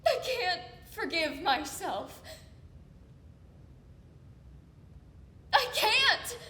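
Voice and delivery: anguished, crying outburst with broken pauses; tearful self-loathing. Acted. Confessing to someone hurt by them, tearful anguish. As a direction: force the words out through sobs, voice rising.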